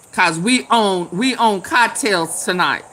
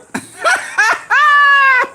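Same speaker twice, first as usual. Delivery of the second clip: high cackle of real laughter; shrill laugh; 4 dB louder than usual. Real, not acted. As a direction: burst into a shrieking laugh. Tipsy host cracking up at chat.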